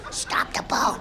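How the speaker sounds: soft unvoiced whisper; whispered plea. Skier begging friends to stop the boat, whispered.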